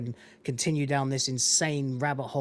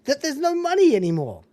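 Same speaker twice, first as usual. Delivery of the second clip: rising rant; 7 semitones higher, 4 dB louder than usual. Real, not acted. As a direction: pitch climbs high, getting louder.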